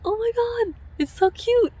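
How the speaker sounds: high, bright burst of joy over the haircut; delighted squeal. Real, not acted. Thrilled at her own haircut, a squealing burst of joy. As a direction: gush at the mirror, voice jumping up.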